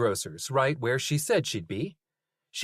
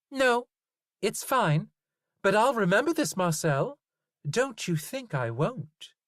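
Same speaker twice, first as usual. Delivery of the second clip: Zoe warns Marcel she'll remember; 11 semitones higher than usual. A narrator's reading. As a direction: threaten the butcher as Zoe.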